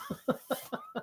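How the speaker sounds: high, soft teary laugh; choked-up laugh. Real, not acted. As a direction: laugh to cover the tears. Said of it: "I'm getting choked up".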